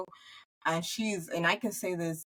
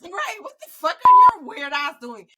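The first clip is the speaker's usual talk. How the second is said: higher, louder scold; mock scolding; 11 semitones higher, 11 dB louder than usual. Friend scolding her, loud high teasing. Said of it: "be like".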